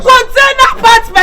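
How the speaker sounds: slow, loud proclamation of the words; loud announcement. Acted. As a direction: announce it slowly like big news. Announcing the container apartment.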